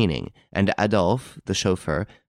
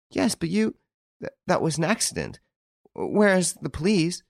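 anxious; 9 semitones higher than usual. A narrator's reading. Rose pleading, frightened.

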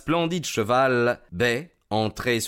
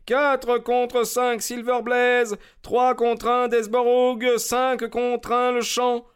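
high, slow, drawn-out bookmaker cry; crowd calling odds; 3 dB louder, slower than usual. A narrator's reading. Parieurs qui hurlent les cotes, crié haut et lent. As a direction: call the odds across the ring. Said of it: "hurlait-on dans le ring".

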